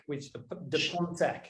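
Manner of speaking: slow, careful French accent on "de Pontac". Shown as a demonstration. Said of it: "my very French accent pulled that off".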